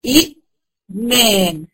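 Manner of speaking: "إيمان" stretched very slowly, long ī held; drawn-out demo. Shown as a demonstration. Teacher shows students "إيمان" with a long, slow madd. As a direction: sing out "إيمان" slowly, holding the first long vowel. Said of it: "أصلها إيمان".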